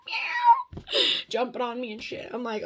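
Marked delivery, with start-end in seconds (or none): 0.1-0.8 high, loud mock cat meow; imitating a meow. Mimicking the cat meowing at her at one in the morning. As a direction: squeal it out like the cat.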